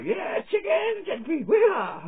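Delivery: hammed-up country cheer for chickens. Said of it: "And they're like".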